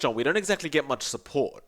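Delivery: ordinary delivery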